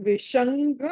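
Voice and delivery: విషంగ with ng sound before ga; velar nasal in విషంగ. Shown as a demonstration. Teacher shows the class nasal, విషంగ with a velar ng. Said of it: "ఙ అని పలకాలి".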